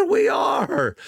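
excited. Retold or imitated. Old man answers eagerly.

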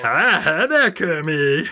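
chirpy Fozzie saying hi; Fozzie Bear. Acted. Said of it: "How about Fozzie?".

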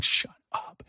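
a hissed, voiceless whisper. Retold or imitated. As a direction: hiss it under your breath at the kids. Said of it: "I'm like".

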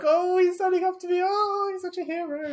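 high mock-swooning voice, slowed; mocking starstruck gush. Mocking the surgeon to a colleague in a swooning voice. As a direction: gush sarcastically over the hero. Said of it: "and I was like".

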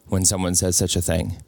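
ordinary talk, nothing marked

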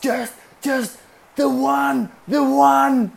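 very high, loud, slow yell; imitating Aito shouting. Aito shouting back which knot over the wind, loud high yell. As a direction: bellow it back across the deck. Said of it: "And Aito's just".

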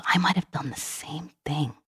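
imitating a child about to cry; slow, whispered, tearful admission; near tears. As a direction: confess it softly as if close to crying. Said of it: "he was about to start crying".

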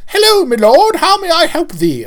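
put-on high theatrical ye-olde shop-girl voice; mock Renaissance Fair greeting. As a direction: gush like a costumed fair clerk, hammed up. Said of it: "that Renaissance Fair affectation".

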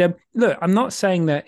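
usual speaking voice

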